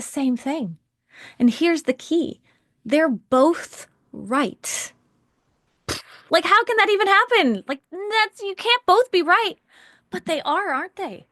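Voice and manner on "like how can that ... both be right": high, loud, mock disbelief; incredulous objection. Coach voices a doubter hearing both sides are right, incredulous. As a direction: protest in raised-voice disbelief.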